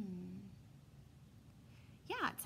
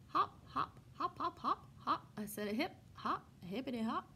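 bouncy hopping; 4 semitones higher than usual. Acted. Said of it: "we take bunny steps".

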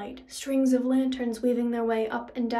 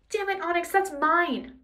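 higher-pitched protest; exasperated, raised-pitch outburst; indignant cry; 7 semitones higher, 3 dB louder than usual. A narrator's reading. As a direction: cry out at the thief. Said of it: "Percy cried".

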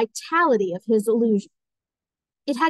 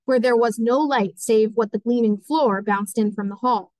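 plain reading voice

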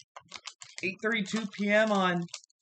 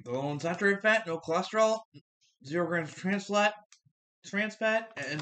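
speaking between bites; chewing while talking. Real, not acted. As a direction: mouth partly full. Seen 1: took chip before, jaw working, mouth moving during words.

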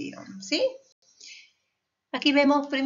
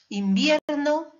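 invierno slow and louder, stretched on VIER; stress on penultimate syllable; 4 semitones higher, 5 dB louder, slower than usual. Shown as a demonstration. Teacher listing grave words, invierno stressed on VIER. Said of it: "el golpe de voz está en la penúltima sílaba".

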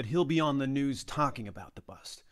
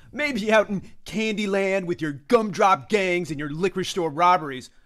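loud, scornful mockery; acted sneering put-down; sarcastic ridicule; 8 semitones higher, 14 dB louder than usual. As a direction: belittle the rookie's small-town beat. Veteran cop ridicules rookie.

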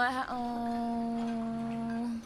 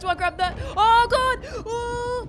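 high frantic panicked yelling; 10 dB louder than usual. Real, not acted. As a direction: yell in a rush of panic.

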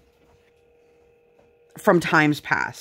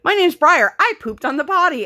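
put-on high, louder proud kid voice; imitating toddler; 11 semitones higher, 4 dB louder than usual. Mother voicing Briar telling a stranger about the potty, high and loud childlike. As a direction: announce it like a beaming small child. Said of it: "she goes".

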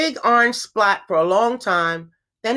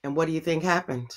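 put-on quick, softer prompt to children; teacher's question; 5 semitones lower, 6 dB quieter, faster than usual. Shown as a demonstration. Teacher asking children to predict what happens, quick prompt. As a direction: ask the class to predict. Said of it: "That's what we call prediction".

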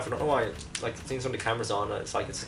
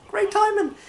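very high, loud mock delight; sarcastic cheer; 7 dB louder than usual. Real, not acted. Gamer interrupted by phone messages, high loud sarcasm. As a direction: squeal it with fake enthusiasm.

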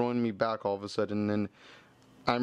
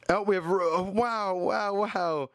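excited; 6 semitones higher, slower than usual. Real, not acted. Pulls a good card from pack.

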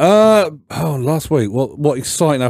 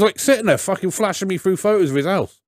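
normal talking voice

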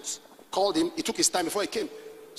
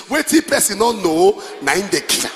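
loud pidgin proverb, Wetin punched; Nigerian pidgin; 9 dB louder than usual. Shown as a demonstration. Preacher quotes scripture in Nigerian pidgin. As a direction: proclaim it like a street saying. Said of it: "The pidgin translation of that scripture is".

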